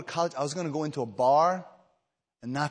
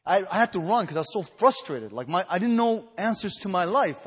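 ordinary delivery

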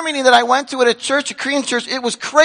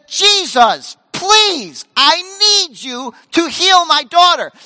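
pleading, higher and slow; pleading father; 6 semitones higher, much slower than usual. Retold or imitated. Desperate father begging Jesus, slow high plea. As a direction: beg for your daughter's life. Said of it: "he falls at his feet".

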